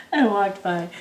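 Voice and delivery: ordinary delivery, nothing marked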